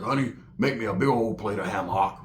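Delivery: low, quiet lazy request; lightly voiced low spun-out uncle; low mumbled order to his lady; Roach's voice.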